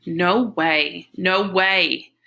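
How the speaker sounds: acted denial. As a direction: protest it firmly, acting the shock. Narrator acts out frantic denial.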